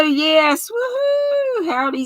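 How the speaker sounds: normal talking voice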